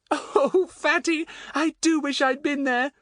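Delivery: very high, admiring little voice; excited Betts, delighted. A narrator's reading. Betts to Fatty about his story, delighted high voice.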